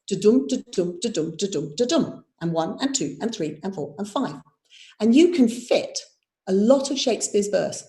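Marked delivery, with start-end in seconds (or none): 0.1-1.7 da-dum, da-dum beaten out like a heartbeat, weak then strong; iambic rhythm. Shown as a demonstration. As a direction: tap out "da-dum" as an even heartbeat. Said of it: "makes it sound like this".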